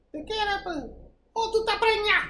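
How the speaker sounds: high-pitched put-on Spanish voice; imitating Spanish mother. Mother reading daughter's dream as pregnancy, high mock verdict. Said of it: "if we were women".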